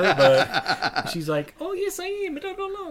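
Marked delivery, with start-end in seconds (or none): 1.6-2.9 high, laughing imitation of a New Zealand accent; Kiwi accent. New Zealand woman answering "are you a Kiwi", imitated Kiwi accent. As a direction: imitate a chirpy Kiwi, pitched well up. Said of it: "Sweet Kiwi accents".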